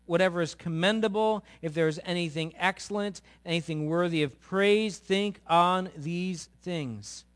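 loud, lifted preaching of the verse; preaching scripture. Acted. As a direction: proclaim it to the congregation. Preaching scripture at full force.

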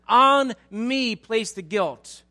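very loud, high and slow; impassioned pleading. Retold or imitated. Abigail bowing down to take the blame, loudly pleading. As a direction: draw out each word in a forceful plea. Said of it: "She's pleading for mercy".

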